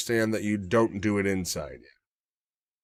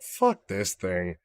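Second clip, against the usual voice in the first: muttered disgust; 4 semitones lower, 5 dB quieter, much slower than usual. Retold or imitated. Disgusted at harness.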